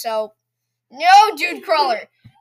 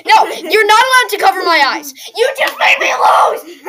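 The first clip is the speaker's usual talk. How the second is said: real yelling, close to tears; frustrated shout; 6 semitones higher, 9 dB louder than usual. Real, not acted. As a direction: yell it, upset and loud. Sister covers his eyes mid-game.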